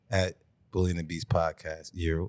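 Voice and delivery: low, soft monotone plug; talking too low. Real, not acted. Co-host recites the podcast handle, low and quiet. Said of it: "They say you talk too low".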